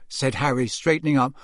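plain reading voice

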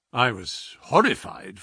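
plain reading voice

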